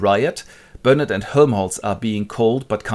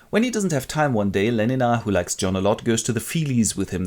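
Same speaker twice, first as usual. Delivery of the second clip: ordinary delivery, nothing marked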